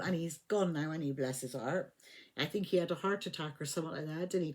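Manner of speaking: ordinary delivery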